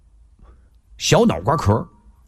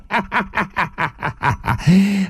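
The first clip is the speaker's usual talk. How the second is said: long staccato ha-ha laugh; hearty stage laugh; 5 semitones higher than usual. Acted. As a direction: laugh out in a long acted run. Acted laugh at the reunion. (一连串哈哈大笑)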